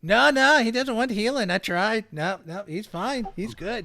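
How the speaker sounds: high sing-out protest, softening as it goes; goblin healer brushing off. Acted. Goblin after his healing is declined, high-pitched dismissal.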